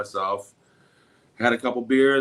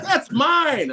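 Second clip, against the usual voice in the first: loud, very high squawk; outraged protest; 7 dB louder than usual. Retold or imitated. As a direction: imitate an alarmed owner claiming the package. Woman protests her package being grabbed.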